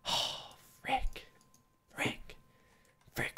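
whispered, deflated curse; barely audible muttering; muttered dismay. Real, not acted. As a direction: curse softly under your breath. Muttered curse after losing.

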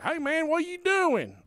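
put-on high, cheery good-ol-boy greeting; cheerful Jake. Jake greeting the teller out front, high and cheery. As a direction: greet him brightly like Jake.

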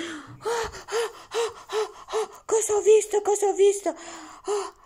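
high, amazed gasps; surprised. Pretend. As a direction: gasp at a sudden discovery. Discovering something underwater.

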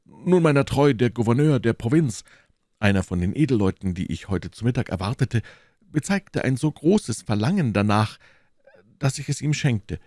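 lively blustering character voice; self-justifying host. Acted. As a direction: explain your generosity grandly. Reader voices the explaining host.

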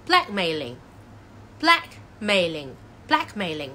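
teacher's drawn-out "blackmailing", L opening the last syllable; careful repeated "blackmailing" with pauses; model pronunciation. Shown as a demonstration. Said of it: "牽涉到L嘅生字呢".

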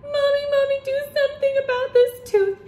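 put-on high, loud wail of a child; a loud, high-pitched child's complaint; yelled plea. A narrator's reading. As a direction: yell for mommy in a high kid voice. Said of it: "took a bite and yelled".

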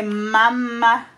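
loud, drawn-out syllables. Real, not acted. Coaxing baby to say word.

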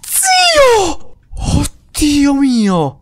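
very high, stretched startled cry; startled shriek. Real, not acted. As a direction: scream in sudden fright. Sudden scare in game.

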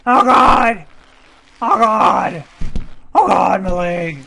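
loud, high cries of alarm; panicked yelling. Real, not acted. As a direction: cry out over a wrecked leg. Game character's leg is injured.

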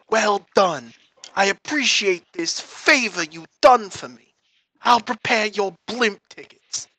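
slow, measured crime boss; a godfather settling up with an informant; mafia Don. Acted. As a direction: mob boss addressing a snitch.

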